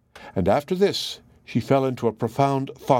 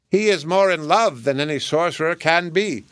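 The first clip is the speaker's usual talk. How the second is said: parrot voice; 6 semitones higher than usual. Acted. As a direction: croon it like a smitten bird. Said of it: "still counterfeiting the parrot's voice".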